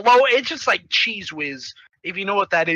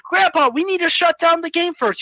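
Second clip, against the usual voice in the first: high-pitched urgent protest; urgent kid; 8 semitones higher than usual. Retold or imitated. Judy stopping Grandpa at the exit, high urgent voice. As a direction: plead high, as the kid hero.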